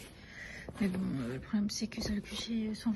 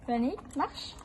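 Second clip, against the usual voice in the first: rising walk-on command; horse command; 4 semitones higher, slower than usual. Real, not acted. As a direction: urge the horse forward. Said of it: "Non, encore".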